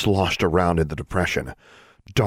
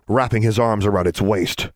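ordinary delivery, nothing marked

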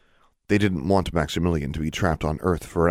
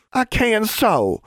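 put-on high, slow defiant girl; defiant declaration; 4 dB louder, much slower than usual. A narrator's reading. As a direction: declare it stubbornly. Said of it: "Innocence declared".

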